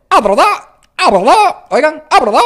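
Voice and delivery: "ah, verdad" pushed out in a strangled cry; loud, throaty broken delivery; swallowed scratch voice. Shown as a demonstration. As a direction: mix a shriek into "verdad". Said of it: "lo van a mezclar con".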